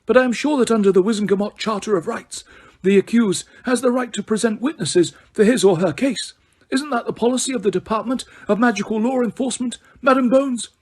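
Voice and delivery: mild, courteous and pleasant. A narrator's reading. Dumbledore gently correcting Fudge before the court, pleasant. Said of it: "said Dumbledore pleasantly".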